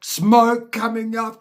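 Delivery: slow, loud and high, building drama; excited. Real, not acted. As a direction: spell out the disaster loudly. Dramatic peak of acid story.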